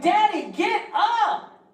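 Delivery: put-on high, slow whiny child; little girl voice. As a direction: whine like a small hungry daughter. Voicing little daughter.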